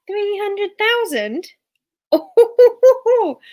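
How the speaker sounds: high, loud, slow disbelief with a laugh; amazed. Real, not acted. Streamer reacting to a viewer's huge watch hours, laughing astonishment.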